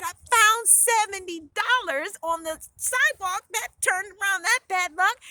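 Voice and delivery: loud, high, proud announcement; excited recounting. A narrator's reading. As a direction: share the lucky find triumphantly. Heroine excitedly tells family everything.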